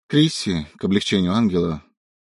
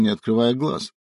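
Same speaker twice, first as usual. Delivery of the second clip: plain reading voice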